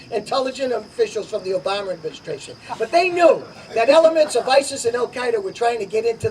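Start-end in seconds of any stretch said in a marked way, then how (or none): 0.1-2.6 quick, softer sarcastic repeat, barely keeping a straight face. Real, not acted. Candidate mocks Obama officials to the crowd, softer quick sarcastic aside. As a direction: toss it off with mock disbelief. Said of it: "hard to say it with a straight face".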